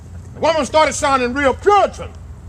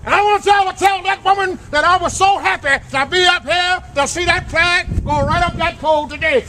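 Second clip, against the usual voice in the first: ordinary talk, nothing marked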